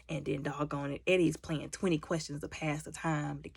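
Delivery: usual speaking voice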